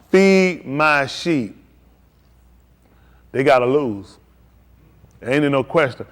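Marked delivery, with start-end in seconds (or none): 0.1-1.6 each word drawn out slowly, solemn; slowed-down command. Retold or imitated. Jesus telling Peter again, slow and weighty.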